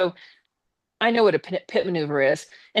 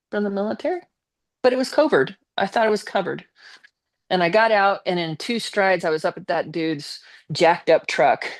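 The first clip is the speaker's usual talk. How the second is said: ordinary delivery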